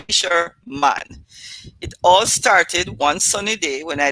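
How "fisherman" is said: "fisherman" slow and deliberate; "fisherman" spoken slowly for emphasis; stressed fisherman. Shown as a demonstration. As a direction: drag out "fisherman" pointedly. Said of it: "Yes, I said".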